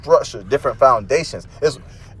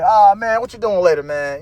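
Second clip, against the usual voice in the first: upbeat greeting; 6 semitones higher than usual. Retold or imitated. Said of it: "And I'm like".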